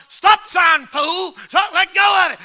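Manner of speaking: put-on yelling to the boy to let go of the belt; retold yell. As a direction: shout him down at the stop sign. Yelling at the boy at a stop sign.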